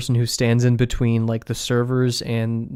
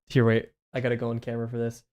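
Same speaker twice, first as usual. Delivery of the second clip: ordinary delivery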